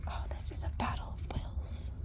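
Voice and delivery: soft unvoiced whisper; hushed aside. Real, not acted. Whispering to viewers during training.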